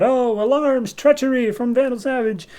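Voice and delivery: put-on high-pitched kid raising the alarm; mock yell. Kid warning everyone of treachery, mock alarmed yell. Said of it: "he yells out".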